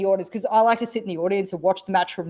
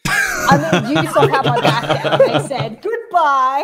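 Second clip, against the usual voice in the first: laughing, louder; amused laughing punchline; 5 semitones higher, 5 dB louder, slower than usual. Real, not acted. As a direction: joke through giggles. Laughing joke.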